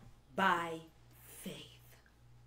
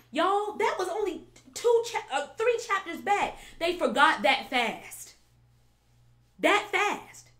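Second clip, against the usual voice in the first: astonished exclaim; 8 semitones higher, 5 dB louder than usual. Real, not acted. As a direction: pitch jumps much higher and louder in disbelief. Preacher exclaiming at Israel's forgetfulness.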